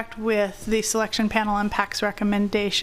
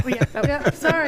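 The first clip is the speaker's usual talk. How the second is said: laughing; 5 semitones higher than usual. Real, not acted. Laughing apology after interruption.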